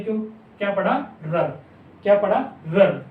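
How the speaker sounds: ordinary delivery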